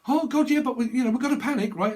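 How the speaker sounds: high, loud mock panic; panicking friend squeaks up high and loud. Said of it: "And someone's like".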